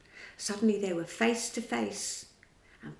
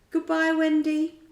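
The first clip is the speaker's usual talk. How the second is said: higher, louder goodbye; farewell call; 7 semitones higher, 8 dB louder than usual. A narrator's reading. Peter calls goodbye to Wendy as he sends her off.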